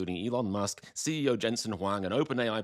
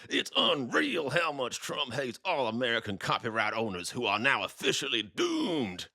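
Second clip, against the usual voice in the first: put-on voice, slowing into the last word; mock doomsaying poster. Copyright owner lamenting online, hammed-up doom. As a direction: draw out the doom theatrically. Reads a post in voice.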